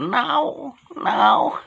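high yowling now; silly cat voice. Acted. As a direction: yowl like a challenging cat. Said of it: "Nakahamon ang loko".

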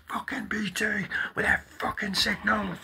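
high-pitched mock rant; imitating own rant. Vlogger imitating himself swearing at BT in front of the priest, high mock rant. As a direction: squeal the curse like a tantrum. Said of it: "And I'd be going".

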